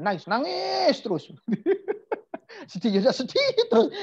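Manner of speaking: high, stretched-out wail breaking into laughter; hammed-up crying, laughing through it; mock wailing. Teasing students who would just keep crying, laughing mock wail. Mocks listeners overdoing permission to cry.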